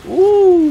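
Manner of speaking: very high, loud ooh; piercing high whoop; excited ooh. Real, not acted. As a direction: squeal out a long ooh. Pleased by the game.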